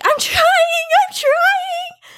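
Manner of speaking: high, strained cry of panic with soap in the eyes; panicked whine. Retold or imitated. Streamer retelling soap in her eyes, high panicked whimper. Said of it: "And I'm like".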